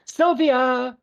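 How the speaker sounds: high, sung-out summons; loud theatrical call, name stretched out. Acted. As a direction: holler for an assistant on a long high note. Shop owner calls the assistant.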